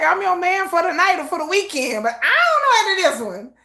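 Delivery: a hammed-up [name] brushing off commitment; playful [name] impression. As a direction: tease like a charming non-committal player. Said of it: "[name] be like".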